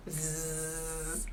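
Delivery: soft imitated mosquito buzz; mimicking the mosquito that won't let you sleep, soft buzz. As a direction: buzz quietly like a mosquito circling your ear. Mosquito keeping you awake.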